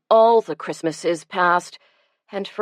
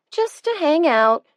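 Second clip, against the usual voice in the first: usual speaking voice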